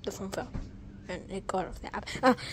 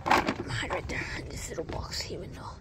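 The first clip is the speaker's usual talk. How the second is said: whisper; 6 dB quieter than usual. Real, not acted. Hiding in the box.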